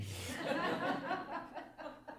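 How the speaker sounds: real high laugh at the punchline; giggling after the punchline; laughing at own joke. Real, not acted. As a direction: crack up at your own joke.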